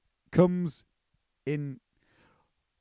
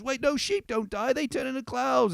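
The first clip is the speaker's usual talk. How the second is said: high, eager, innocent voice; naive little sheep; 10 semitones higher than usual. Retold or imitated. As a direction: chirp it like a naive young sheep. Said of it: "And she goes".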